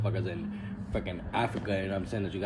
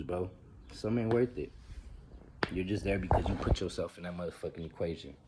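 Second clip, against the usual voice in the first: ordinary delivery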